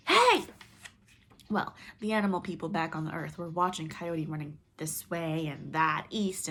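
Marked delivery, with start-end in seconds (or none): 0.1-0.4 shout. Acted. Chasing the sun.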